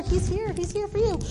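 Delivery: acted hushed excitement; excited news. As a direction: pass on urgent good news. Messengers tell Mary Jesus has come.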